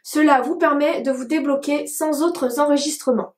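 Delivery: ordinary delivery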